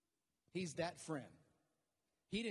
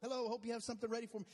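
breezy self-invite; 6 semitones higher, much faster than usual. Acted. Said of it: "He's like".

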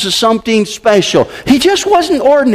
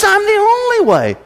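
only raised and stressed, higher; 10 semitones higher than usual. Shown as a demonstration. Preacher correcting the quote, stress on only. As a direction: hit the word only. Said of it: "He says".

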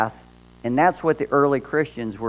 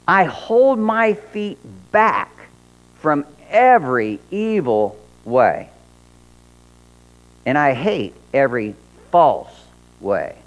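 scripture reading. Acted.

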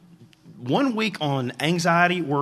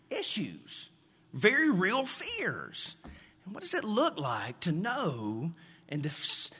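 slow, softer sermon delivery; earnest preaching; 3 semitones higher, 4 dB quieter, slower than usual. Acted. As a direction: press the point gently and slowly.

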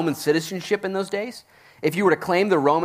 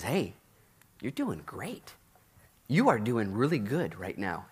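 soft, low, gentle reassurance; softer and lower, like Jesus to Paul; tender comfort; 4 semitones lower, 8 dB quieter, slower than usual. Acted. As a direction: comfort a battered friend quietly.

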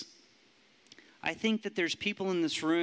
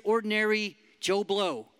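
ordinary talk, nothing marked

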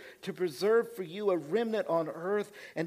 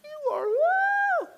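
much higher, stunned squeak of disbelief; a far higher, slowed cry of utter shock; 7 dB louder, much slower than usual. Retold or imitated. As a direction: gasp it out like you can't believe who's standing there. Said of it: "They're like".

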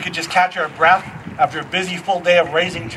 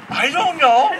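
put-on toddler voice; 6 semitones higher than usual. Toddler answering, laughing.

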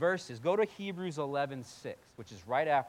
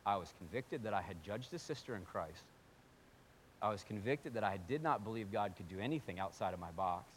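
normal talking voice

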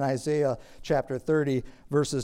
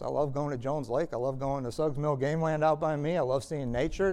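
ordinary delivery

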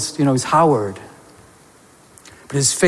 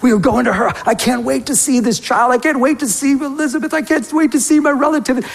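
eager, higher-pitched acted anticipation; excited; 5 semitones higher than usual. Preacher voices Mary setting off to Elizabeth's, excited. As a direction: pile it up like you can't wait. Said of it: "like, excited".

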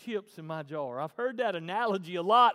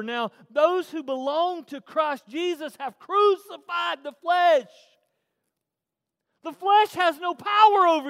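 loud, high preaching; preaching at full force; 9 semitones higher, 11 dB louder, slower than usual. Acted. Pastor to the church, full-force preaching. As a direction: thunder it to the church.

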